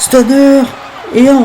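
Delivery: high, loud hype shout; excited shout. Real, not acted. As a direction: yell the move and count. Calling finisher and count.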